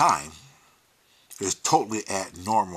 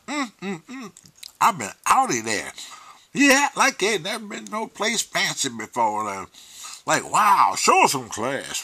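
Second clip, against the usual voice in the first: Linda Week; 4 semitones higher, 4 dB louder than usual. Retold or imitated. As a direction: act out an appalled scolding, pitch jumping up. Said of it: "Linda Week".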